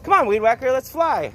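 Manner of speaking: rally the bird to fly; upbeat coaxing call; eager urging. Real, not acted.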